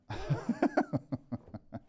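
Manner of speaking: soft, amused cackle; real laugh. Real, not acted. Host claiming a hat for themself, laughing. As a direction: crack up at your own cheeky claim.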